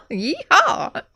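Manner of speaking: high, laughing Southern whoop; playful put-on Southern cheer; mock Southern cowboy. Co-host imitating the narrator, giggly Southern whoop.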